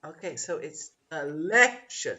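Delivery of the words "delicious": 'delicious' as de-LI-cious, middle beat punched; stressed second syllable. Shown as a demonstration. Teacher answering where the stress is, exaggerated second syllable. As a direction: swell hard on the LI of 'delicious'. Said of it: "Which is the biggest sound?".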